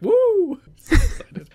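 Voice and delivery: a sudden high, loud woo; mock excited woo. Announcer's spontaneous woo, high and loud. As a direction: yelp it like a thrilled announcer. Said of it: "Mike Tenay's like".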